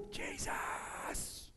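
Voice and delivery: high cracking cry of Jesus; desperate shout. Acted. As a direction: call out over the crowd, straining high. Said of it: "He starts shouting more".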